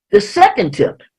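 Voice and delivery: ordinary talk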